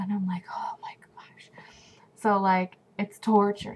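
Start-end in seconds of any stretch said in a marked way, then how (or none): 0.5-1.5 whisper. Retold or imitated. Craving lemons, exasperated.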